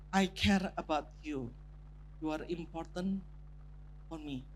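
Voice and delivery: soft, fading tender words; tender confession. Retold or imitated. Husband telling his wife she matters, soft and tender. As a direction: say it gently to your wife. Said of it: "suami ingin menyampaikan kepada istri".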